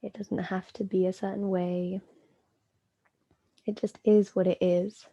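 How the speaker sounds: calm, unhurried yoga nidra guidance with long pauses; measured meditation voice, phrases spaced out; guided meditation. Acted. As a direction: guide them inward, leaving space between phrases.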